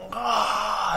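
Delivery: high, drawn-out mock roar; lion's roar. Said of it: "and her lion goes".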